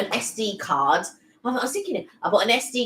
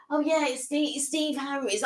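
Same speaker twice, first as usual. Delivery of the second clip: offhand mimic; 5 semitones higher, 4 dB quieter than usual. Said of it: "he doesn't look at me like".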